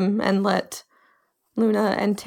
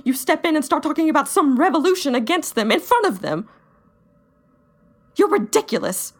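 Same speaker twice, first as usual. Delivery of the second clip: put-on angry young fighter; 4 semitones higher than usual. [name]'s long angry rant in character.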